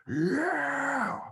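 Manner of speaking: high, drawn-out "yeah" howl; strange heckler yell. Retold or imitated. Heckler yells from the audience, strange high yell. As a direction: imitate a long high yelp from the crowd. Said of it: "That is a strange fucking yell".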